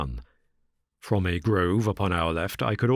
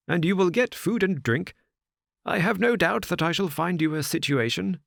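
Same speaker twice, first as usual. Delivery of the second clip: lightly voiced old gentleman, higher; a hint of the old squire giving orders aloud; 11 semitones higher than usual. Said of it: "he continued out loud".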